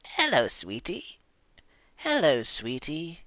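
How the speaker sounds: a light British lilt on hello sweetie; playing the lady in a British accent; mock British accent. Streamer voicing the game lady with a British accent. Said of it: "giving her, like, a British accent".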